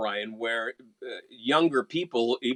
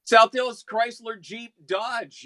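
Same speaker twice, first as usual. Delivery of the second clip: intense, slow, higher car-dealer read; serious ad read; 8 semitones higher, 5 dB louder, slower than usual. Shown as a demonstration. Announcer demonstrating car dealership spot, serious intense read. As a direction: punch out each brand slowly, pitched up. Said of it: "That's my serious read".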